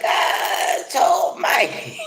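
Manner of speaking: drawn-out exasperated cry, slow; exasperated mock cry. Worn-out woman cries out at energy drainers, exasperated. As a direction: groan it out like you're worn thin. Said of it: "And you're like".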